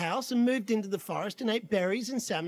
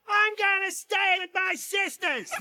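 high, drawn-out mock shout; hammed-up furious wife; Mama Bear shouting; 10 semitones higher than usual. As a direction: holler it as you storm in. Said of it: "She's shouting".

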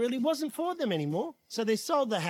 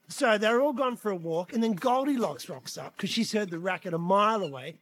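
usual speaking voice

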